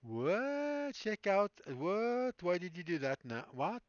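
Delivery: high-pitched, drawn-out disbelief; real bewildered surprise. Real, not acted. Streamer startled as the software misbehaves, high-pitched surprise. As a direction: pitch way up in baffled protest.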